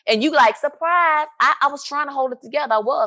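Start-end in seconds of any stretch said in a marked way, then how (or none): 0.6-1.3 mock surprise greeting. Imitates the birthday surprise greeting.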